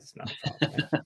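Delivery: whispered, cocky aside; hushed mock boast; smug whisper. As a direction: breathe it like a confident secret. Smug aside about rival candidates.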